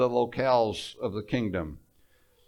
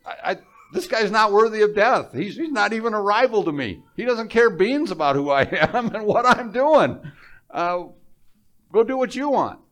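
Pilate brushing it off; 6 semitones higher, 5 dB louder than usual. Retold or imitated. Preacher voices Pilate dismissing charges.

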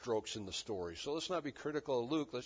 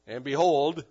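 "behold" loud, swelling on the second syllable; emphatic; 9 dB louder than usual. Acted. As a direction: punch "behold" and hold it up. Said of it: "so emphatic language again".

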